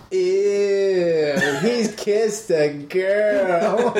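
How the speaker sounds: laughing, high mock-grossed-out voice; mock disgust. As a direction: squeal "ew" through a laugh, slow and high. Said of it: "Tom Cruise is like".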